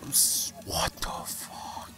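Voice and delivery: slow, whispered disbelief; stunned whisper. Real, not acted. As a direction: breathe it out, stunned. Stunned by drop.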